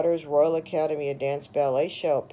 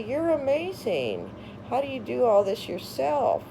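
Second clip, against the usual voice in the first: gushing praise; 7 semitones higher than usual. Retold or imitated. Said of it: "they'd say".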